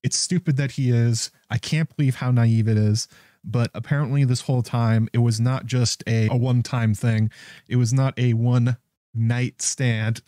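normal talking voice

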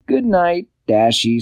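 high, light cat voice; Barnaby's voice. A narrator's reading. Barnaby the cat wishing Dashy good night, high voice. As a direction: pipe it up high and friendly. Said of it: "said Barnaby".

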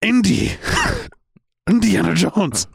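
high, hammed-up Scottish accent; badly faked Scottish, breaking into a laugh; attempted Scottish accent. Shown as a demonstration. Told to try again, shaky fake Scottish accent. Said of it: "I can do a Scottish accent".

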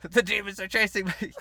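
high, loud, laughing mock terror; mock panic. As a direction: cry out in fake fright while laughing. Joking about demon mosquitoes.

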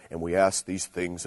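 low, slow recited prayer ending; mock formula, intoned low and slowly; formulaic prayer closing. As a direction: imitate a rote church prayer, drawn out. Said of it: "magic word type of stuff".

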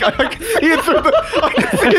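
high-pitched laughing words. Real, not acted. Laughing at the monster in window.

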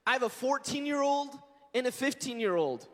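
ordinary talk

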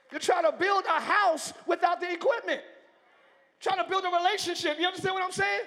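loud, high, driving sermon voice; preaching at full force. Acted. Pastor pressing the congregation to need the word, full-force preaching. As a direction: build it up and hammer every line.

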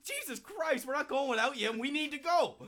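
exasperated. Retold or imitated. Exasperated with drunk friend.

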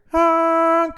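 loud, high imitated horn blast; car horn honk. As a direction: blare out a sudden car horn. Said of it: "all of a sudden I hear".

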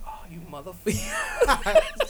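high, slow, laughing mock outrage; mock outraged girlfriend. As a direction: squeal it like she's caught you out. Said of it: "Where she's just like".

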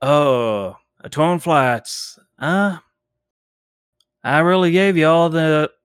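lightly voiced preacher warning of judgment; a touch of a fire-and-brimstone stranger; doom-saying zealot. GM as the cultist warning the party off the town, mild sermon tone. Zealot answers about news.

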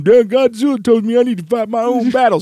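high-pitched character impression; Minya. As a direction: put on a high little monster voice. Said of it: "Minya going".

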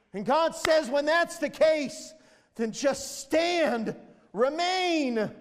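high, insistent sermon cry; impassioned preaching. Real, not acted. Preacher telling worn-down believers to stand their ground, impassioned preaching. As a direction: preach it with rising conviction.

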